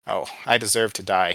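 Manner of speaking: plain reading voice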